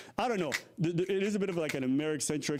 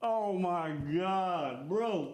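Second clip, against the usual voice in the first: exasperated; 6 semitones higher, much slower than usual. Real, not acted. Exasperated drawn out.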